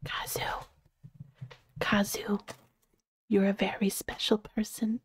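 soft unvoiced whisper. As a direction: whisper it gently into the mic. ASMR affirmation to a friend.

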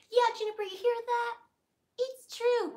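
high, loud teasing voice; high excited pet talk. Real, not acted. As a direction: tell the pet to listen.

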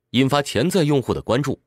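ordinary talk

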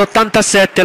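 ordinary talk, nothing marked

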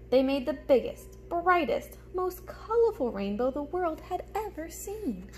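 put-on lively storybook reading for little kids; reading to children. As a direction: read it like story time for a class. Reads picture book aloud.

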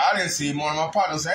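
normal talking voice

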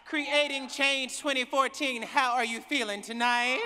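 loud call to the crowd, rising on how. Real, not acted. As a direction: greet the whole hall with a loud call. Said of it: "I don't know if I believe you".

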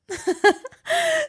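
loud, high giggle; high giggling fit; high laughing burst. A narrator's reading. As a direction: shriek with laughter.

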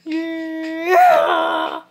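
high yell swelling into a scream. Real, not acted. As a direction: let a long yeah burst into a scream. Yell during game.

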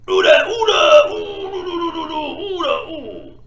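high, loud yelps of oula, slow and drawn out, fading into laughter; panicked yelps. Real, not acted. Yelping in panic as the girl fighter hits him. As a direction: yelp in alarm as each hit lands.

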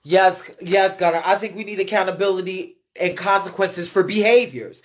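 a hint of an excited fan agreeing; reading Gunner's comment. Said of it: "Gunner says".

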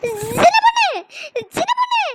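high, loud cry for help; panicked shout. Acted. Woman spots a ghost and calls her friend, panicked shout. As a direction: call out in rising alarm.

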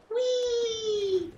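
very high, drawn-out squeal; gleeful high "whee"; playful whee. Pretend. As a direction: squeal it like going down a slide. Playful cheer jumping into water.

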